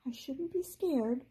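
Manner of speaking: small, high, slow fairy voice; a tiny trapped fairy bracing herself. A narrator's reading. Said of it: "said a tiny voice".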